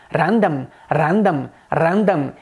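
"random" with a rolled Indian R; Indian English accent. Shown as a demonstration. Teacher showing the rolled R, Indian accent. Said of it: "Você pode dizer em inglês indiano".

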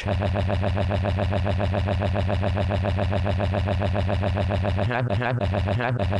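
long, droning stuttered noise; monotonous machine-gun "ha-ha-ha"; imitated noise. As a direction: rattle off one syllable mechanically, on and on. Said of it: "fucked the piano like".

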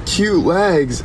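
high teasing holler; mock grandma yell. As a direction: yell it out the car window like a cheeky grandma. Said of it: "just yells".

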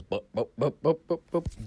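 rising high boop boop pour noises; pouring sound effect. Retold or imitated. Pouring himself a drink, imitating the glug sound. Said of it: "I'm like, it goes".

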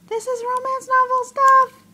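high, loud, thrilled squealing. Real, not acted. Finding the romance novel stuff, thrilled squeal. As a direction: squeal the words in real excitement.

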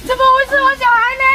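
high-pitched alarmed demand; panicked. Acted. Mother searching for her missing child, panicked. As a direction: call out in rising panic.

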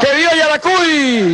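rousing rally shout; booming balcony speech. Real, not acted. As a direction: call it out to the crowd. Rally chant of vivas.

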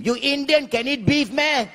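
very high screechy outburst; scandalised, high-pitched auntie screech; auntie screaming. Retold or imitated. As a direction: scream it across the counter. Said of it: "From the counter, she scream".

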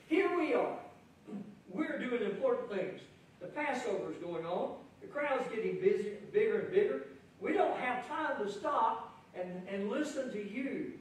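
pushy insider who has no time for him; impatient disciples. Acted. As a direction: play the busy disciples, annoyed at the holdup. Preacher voicing impatient disciples.

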